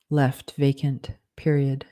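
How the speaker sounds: plain reading voice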